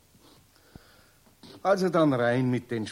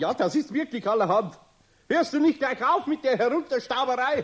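schimpfend; 9 semitones higher, 3 dB louder than usual. Acted. Eder schimpft den unsichtbaren Pumuckl.

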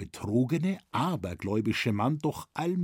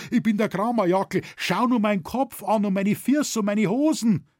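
high, loud pleading protest; quick, raised, desperate-sounding appeal; crying out; 9 semitones higher, 5 dB louder than usual. A narrator's reading. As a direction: insist loudly on who you are. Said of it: "rief er jetzt".

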